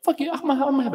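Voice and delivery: sudden high angry burst; high outburst. Real, not acted. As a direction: blurt it out high. Outburst at accent claim.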